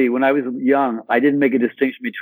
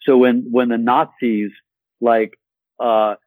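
ordinary talk

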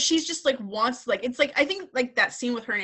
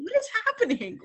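high-pitched baffled reaction; mock bewilderment; 14 semitones higher than usual. Host recalls hearing the bad Southern accent, mock bewilderment. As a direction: play up the disbelief, pitched high. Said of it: "I was like".

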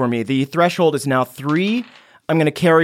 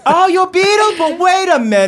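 higher, loud, thrilled mom; excited mother; 4 dB louder than usual. Acted. Mother recognises her son as the trending Beetle Boy, thrilled mom. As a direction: she just realised who he is.

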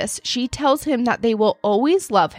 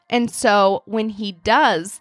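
ordinary delivery

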